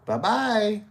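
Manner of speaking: high singsong send-off; mock dismissive farewell. Car salesman sending haggler away, retold mocking dismissal.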